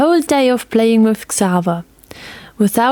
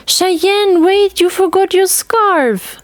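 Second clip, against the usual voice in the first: high, slow call across the room; grandmother calling after; 11 semitones higher, slower than usual. A narrator's reading. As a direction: call her back before she's gone. Grandmother calls after girl.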